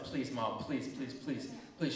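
low, hushed begging; small-voiced child's plea; soft pleading. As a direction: wheedle mom quietly, please after please. Child begging mom for toy.

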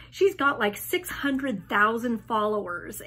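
usual speaking voice

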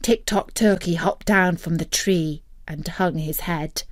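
plain reading voice

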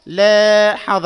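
loudly, slowly stretch "لا" in "لاحظ"; drawn-out long vowel. Shown as a demonstration. Teacher analysing the word for students, drawn-out long vowel. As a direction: hold a high long a in "لاحظ". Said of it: "مد مع الممدود".

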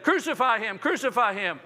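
crowd shouting. Retold or imitated. Crowd demands crucifixion.

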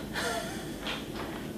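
a quick airy ha; a short light laugh from the belly; brief laugh to feel the belly move; little laugh. Shown as a demonstration. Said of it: "try a little laugh".

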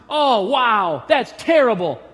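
loud, overdone sympathetic gasp; a commenter gushing fake pity; mock sympathy. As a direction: ham up a gushing online comment. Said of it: "I'm reading the inflection into the".

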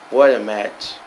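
normal talking voice

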